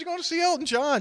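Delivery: high, incredulous question; sky-high disbelief at the concert news; mock surprise. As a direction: act amazed at the news. Retelling his own surprised reply.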